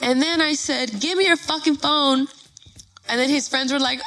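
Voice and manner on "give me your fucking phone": raised-pitch retold angry demand; worked-up, higher-pitched order. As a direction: demand it indignantly, as if reliving it. Retold demand after slapping him.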